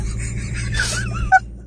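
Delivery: burst of laughter. Real, not acted.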